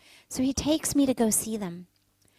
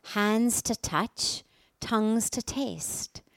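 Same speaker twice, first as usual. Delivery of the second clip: slow, measured inspirational host read; radio host intro; 4 dB louder, slower than usual. Acted. Host welcomes listeners back to the show, slow and measured. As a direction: welcome listeners slowly, weighing each word.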